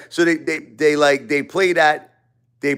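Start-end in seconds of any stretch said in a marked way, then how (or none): none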